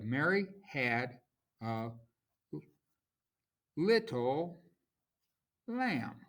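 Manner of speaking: "Mary had a little lamb" broken word by word, punching MAR and LIT; measured word-by-word beat, stressing "Mary", "little" and "lamb"; scanning the stresses. Shown as a demonstration. Teacher marking stressed syllables, "Mary had a little lamb" chopped into beats. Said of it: "So we have a stressed, unstressed".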